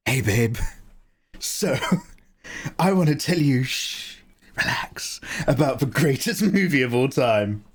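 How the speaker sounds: put-on hushed boyfriend asmr voice, half-laughing; whispered asmr role play. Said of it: "we're gonna do A S M R roleplay".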